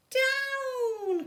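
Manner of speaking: high sharp cry of Down; the witch calls her broom down, high and loud; cried out. A narrator's reading. Witch calls broom down a third time, high shout. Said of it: "cried the witch".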